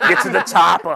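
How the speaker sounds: imitated action-hero line through laughter; lightly mock a movie catchphrase; Arnold Schwarzenegger. Retelling a comedian's impression, amused action-hero imitation. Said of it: "he did the Arnold Schwarzenegger too".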